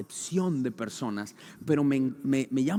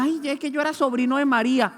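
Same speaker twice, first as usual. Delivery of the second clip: quick, higher mock plea; whiny excuse; 9 semitones higher, 7 dB louder than usual. Preacher mocking someone pleading family ties before God, quick and higher. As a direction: beg off like a spoiled nephew, hammed up.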